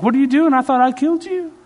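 high, loud, incredulous outburst; mock astonishment. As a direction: act out shocked disbelief at a foe come back. Acted shock at resurrected flesh.

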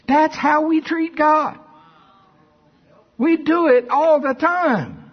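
high, slow, drawn-out exclamation; full-force preaching. Real, not acted. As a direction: drive it home to the congregation, high and slow.

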